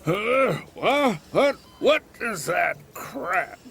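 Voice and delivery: put-on cranky, startled old man; irritable old man jolted awake; grumpy old man. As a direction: snap awake and grumble. Old man woken, grumpy outburst.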